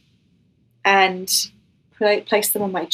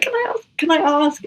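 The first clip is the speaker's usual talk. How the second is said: retold plea in a small, much higher voice; tentative request; 8 semitones higher than usual. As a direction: pipe up high, imitating a hesitant question. Retells timidly asking Jesus a question.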